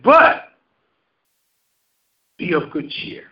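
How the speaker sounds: "But" called out, silence, "be of good cheer"; pause after but. Shown as a demonstration. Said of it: "another pause there".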